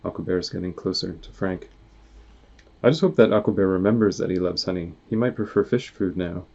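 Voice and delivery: ordinary delivery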